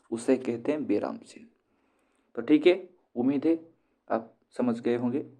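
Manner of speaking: ordinary talk, nothing marked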